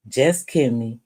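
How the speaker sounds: ordinary talk, nothing marked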